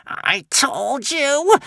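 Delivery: very high, slow, sneering witch hiss. A narrator's reading. The witch gloating over the doomed Vikings, slow high hiss. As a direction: drag out the gloating like a crone. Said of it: "hissed the witch".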